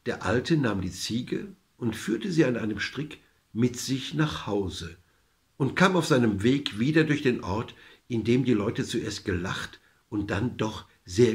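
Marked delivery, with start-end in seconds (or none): none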